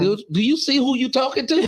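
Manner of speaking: high, sassy, playful; sassy mock challenge. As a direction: tease like a cheeky patient. S2 voicing the patient's defiance.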